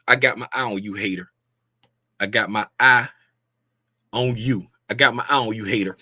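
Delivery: low, softened mock warning; mock menace. Streamer warning one hater off disliking, low mock menace. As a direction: drop low and warn them playfully.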